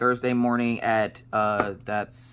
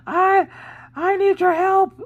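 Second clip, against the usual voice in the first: very high, loud squeaky plea; hammed-up helpless wail, drawn out; mock wail; 8 dB louder, slower than usual. Wife pleading with husband for help, mock wail. Retold panicked plea to partner.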